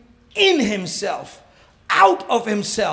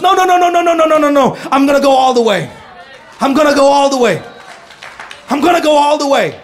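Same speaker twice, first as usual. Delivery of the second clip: determined shout; 2 semitones higher, 8 dB louder, faster than usual. Acted. Jesus in the garden refusing to quit, loud determined cry. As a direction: shout it like nothing will stop you.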